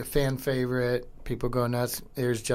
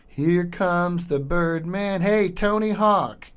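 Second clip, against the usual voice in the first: loud, slow, higher arena call; announcer hype; 6 semitones higher, 7 dB louder, slower than usual. Real, not acted. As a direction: bring in the Birdman like a stadium announcer. Hyping the Birdman's entrance.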